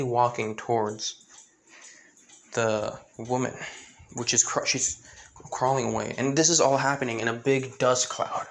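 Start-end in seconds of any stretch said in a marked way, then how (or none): none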